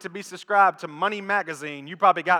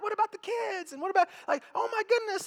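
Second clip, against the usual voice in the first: high, shrill-free gasping disbelief, much higher; shocked friends; 12 semitones higher, 5 dB quieter than usual. Retold or imitated. Friends at the funeral shocked at the widow, high mock disbelief. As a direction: squeal it out like scandalized church ladies. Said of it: "And they're like".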